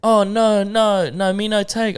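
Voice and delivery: high-pitched put-on broken English accent; mock broken English. Mocking tourists in Bali, put-on broken English. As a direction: imitate someone putting on broken English. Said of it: "start speaking broken English".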